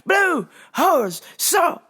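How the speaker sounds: mock customer yell, high and drawn out; imitated yelling. Customer to worker four feet away, yelling. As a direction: bellow each word like he can't hear himself. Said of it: "but then would yell".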